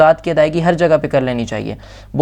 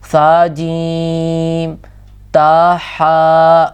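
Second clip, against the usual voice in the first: slow recitation; 3 dB louder, much slower than usual. Shown as a demonstration. Teacher drills letter names.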